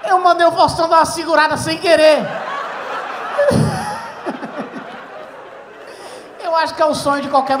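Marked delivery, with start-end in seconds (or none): 0.1-2.3 loud, shouting through laughter; laughing shout. Real, not acted. Comedian confesses to the crowd, laughing shout. 2.3-6.4 high wheezing laughter; high breathy laugh. Real, not acted. Comedian cracking up at own story, high airy laugh.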